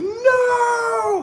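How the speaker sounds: long, very high scream; high anguished mock scream. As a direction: wail it out in horror. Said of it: "He screamed".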